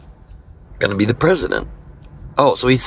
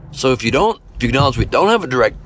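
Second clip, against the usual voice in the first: ordinary delivery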